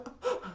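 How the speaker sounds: sharp breathy anime gasp; a quick dramatic intake of breath. Shown as a demonstration. Mocking the anime cliché, a quick gasp. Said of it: "like, the anime gasp".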